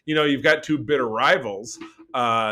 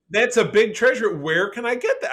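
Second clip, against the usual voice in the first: higher and louder, delighted at the find; light up like you found treasure; thrilled; 9 semitones higher, 3 dB louder than usual. Real, not acted. Finding a rare comic, thrilled.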